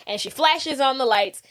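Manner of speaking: plain reading voice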